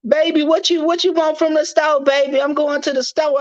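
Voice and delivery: high, sweet-voiced woman calling to her baby; imitating the new girlfriend, high and sweet; woman's voice. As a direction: lilt it up like a girlfriend calling from the next room. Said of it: "Then I heard some woman".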